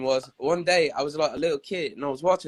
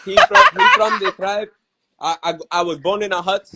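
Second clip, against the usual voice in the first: put-on Nigerian accent; 5 dB louder than usual. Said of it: "the one from Nigeria".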